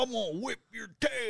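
high, hammed-up cowboy threat. Cowboy [name] threatens his buddy in a high mock voice. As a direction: ham up the tough cowboy. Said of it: "Cowboy [name]".

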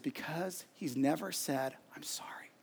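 hushed, retold hurt fading to a whisper; tearful mother. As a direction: voice mom's hurt very softly, slowly. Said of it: "with tears in her eyes".

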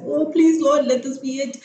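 high, mildly pleading retold prayer; hopeful plea. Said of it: "I was hopeful".

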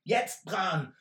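calling out. Acted. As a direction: yell the signal to run. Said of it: "da rief Wilbert".

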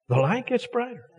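lifted high and gentle on gets. Shown as a demonstration. Pastor repeats the line high to the congregation, lifted and soft.

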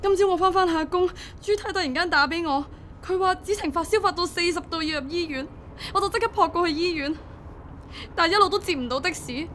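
frightened parent recounting an emergency; distraught worry. Acted. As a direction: pour out the whole ordeal in rising panic. Panicked mother recounting child's hospital admission.

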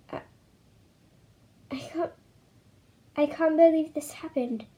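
ordinary delivery, nothing marked

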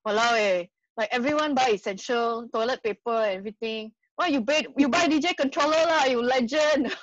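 casual Singlish impression of a friend. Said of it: "emulate him speaking, like, Singlish".

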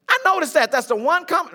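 high and loud, heating up; getting angry. Real, not acted. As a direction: let the anger rise. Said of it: "I told you I get angry".

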